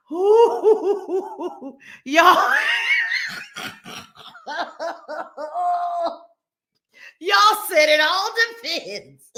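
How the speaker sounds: laughing too hard to talk; real helpless laughter. Real, not acted. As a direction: squeeze the words out between laughs. Laughing hard at chat puns.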